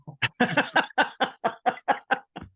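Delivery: real airy laughter; breathy real laugh; long laugh. Real, not acted. Laughs at the memory.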